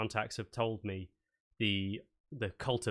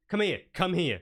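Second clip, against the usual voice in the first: high, louder, fussing summons; exasperated summons; 7 semitones higher, 5 dB louder than usual. Acted. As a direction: call them over impatiently. Shopkeeper scolds and summons her.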